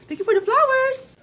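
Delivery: high, sweet voice thanking viewers on live; sugary livestream voice. Acted. Mother on TikTok live thanking viewers, high sugary voice. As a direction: gush at your viewers like a streamer.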